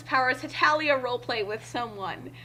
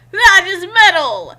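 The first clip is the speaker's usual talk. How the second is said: loud, high burst of delight; loud, high, hyped-up cry; excited shout; 6 semitones higher, 12 dB louder than usual. Acted. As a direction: belt it out like a rock fan thrilled. Character exclaims excitedly about guitar.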